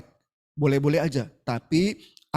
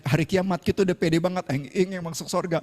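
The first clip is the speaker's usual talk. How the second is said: ordinary talk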